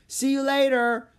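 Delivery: high, loud call after her. Acted. Annie calling goodbye to Sylvia, high and loud. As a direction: call it out across the path. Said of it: "Annie called".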